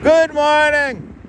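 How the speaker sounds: high-pitched, drawn-out mock greeting; joke "regular voice". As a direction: sing out "Good morning" way up high, slowly. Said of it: "That's my regular voice".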